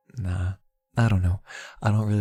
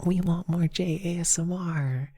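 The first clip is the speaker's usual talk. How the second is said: imitating chat; 6 semitones higher than usual. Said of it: "Everyone's like".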